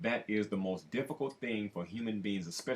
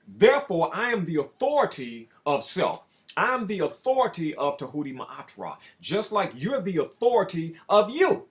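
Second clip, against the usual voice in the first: loud, rising sermon delivery; full-force preaching; 6 semitones higher, 9 dB louder than usual. Acted. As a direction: proclaim it like a preacher at the pulpit.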